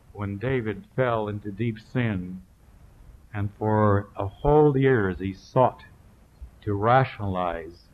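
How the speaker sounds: ordinary delivery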